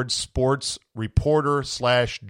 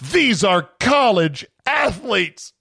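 high, slow, loud mock outrage; mock announcer yell; 8 semitones higher, 3 dB louder, slower than usual. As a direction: quote the tweet like a horrified announcer. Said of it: "He went full J R on that one".

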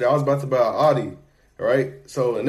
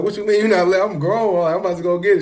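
indignant protest; 6 semitones higher, 4 dB louder than usual. Retold or imitated. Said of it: "I'm like".

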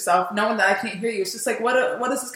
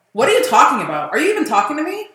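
retold annoyance; 7 semitones higher, 8 dB louder than usual. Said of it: "'cause I was so annoyed".

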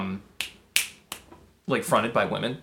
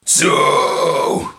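death metal growl demo; much slower than usual. Shown as a demonstration. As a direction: growl the count like a metal singer. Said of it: "Don't go up".

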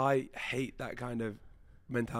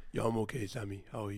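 deep chesty voice; 3 semitones lower, 5 dB quieter than usual. Retold or imitated. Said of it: "You sound so deep".

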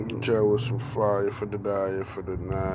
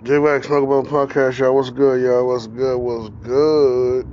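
loud, high hype call; hype shout-out; 5 semitones higher, 14 dB louder than usual. Real, not acted. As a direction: shout it out to the crowd. Podcast shout-out to listeners.

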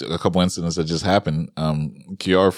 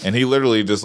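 ordinary talk, nothing marked

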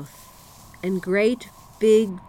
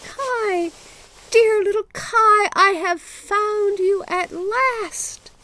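put-on much higher, eager little girl calling out; joyful girl's cry; 13 semitones higher, 5 dB louder, slower than usual. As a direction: cry out his name in joy, high and slow.

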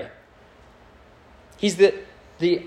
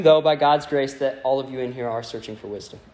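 ordinary talk, nothing marked

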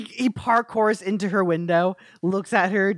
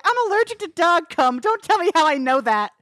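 put-on much higher, louder girly complaint; high Bella voice; 10 semitones higher, 3 dB louder than usual.

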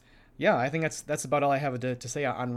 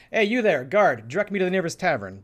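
bold, louder hail to a guard; confident; 5 semitones higher, 6 dB louder than usual. Acted. Player's outgoing character orders a guard about, confidently. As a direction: call out with assured authority, higher and loud. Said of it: "with a confident point, and I might say".

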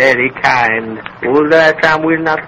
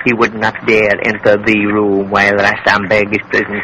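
ordinary delivery

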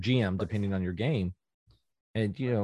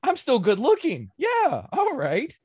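higher, smug self-pleased voice; mock vain boast; 12 semitones higher, 5 dB louder, slower than usual. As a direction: preen over your own looks, tongue in cheek. Imagined boastful reply.

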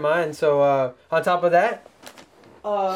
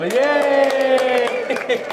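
high happy cheer; excited cheer; 7 semitones higher, 3 dB louder than usual. Real, not acted. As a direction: cheer her in with a bright yay. Cheering for mom.

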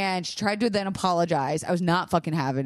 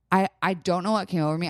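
ordinary delivery

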